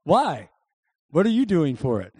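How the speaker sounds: normal talking voice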